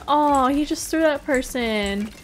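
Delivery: ordinary delivery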